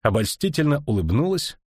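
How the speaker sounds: ordinary delivery, nothing marked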